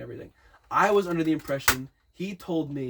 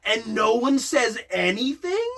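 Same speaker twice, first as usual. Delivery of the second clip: loud, high, drawn-out disbelief; incredulous outrage; 6 semitones higher, 6 dB louder, slower than usual. Retold or imitated. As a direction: let the outrage climb, stressing each word. Outraged reaction in retold scene.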